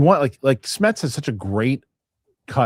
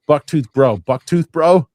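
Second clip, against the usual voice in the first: loud callout; 6 semitones higher, 9 dB louder, slower than usual. Acted. Calling out a viewer name.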